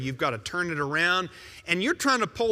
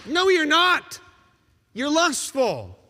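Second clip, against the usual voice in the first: louder and higher, flatly correcting; forceful rebuttal; 8 semitones higher, 4 dB louder than usual. Acted. Preacher rejecting a listener's excuse, forceful and raised. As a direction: cut through the excuse.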